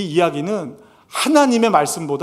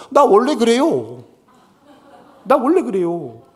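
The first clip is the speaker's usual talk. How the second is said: pastor's mock stubbornness; 5 semitones higher, 3 dB louder than usual. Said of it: "제가 이렇게 말씀드리면".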